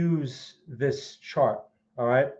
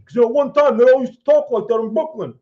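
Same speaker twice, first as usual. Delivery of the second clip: put-on high, loud, thick Brooklyn accent; hammed-up Brooklyn accent, loud and high; 7 semitones higher, 8 dB louder, faster than usual. Streamer to chat, a hammed-up loud Brooklyn accent. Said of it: "I just like to do the impression".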